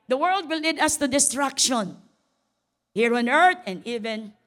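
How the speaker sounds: loud preaching. Acted. Pastor warning the congregation about the world, loud. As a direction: warn them loudly.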